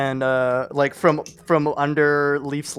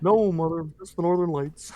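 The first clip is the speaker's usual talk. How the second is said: Principal Skinner voice. Retold or imitated. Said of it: "Simpsons quote impression".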